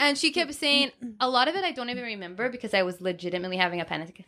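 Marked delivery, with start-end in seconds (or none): none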